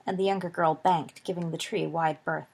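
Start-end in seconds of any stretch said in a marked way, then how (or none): none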